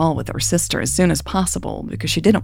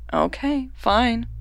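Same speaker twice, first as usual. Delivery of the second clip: higher, softer, grudging concession; relenting; 7 semitones higher, 3 dB quieter, much slower than usual. A narrator's reading. Sister backs off from questioning Raven, relenting. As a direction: give in, light and soft. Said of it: "Jasmine relented".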